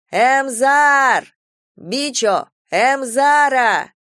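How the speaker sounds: long, high, loud drawn-out call. A narrator's reading. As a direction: call across the fence, stretching the name. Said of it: "звал кого-то парень через забор". (парень громко зовёт через забор, протяжно тянет имя)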